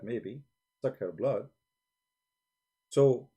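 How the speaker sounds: ordinary talk, nothing marked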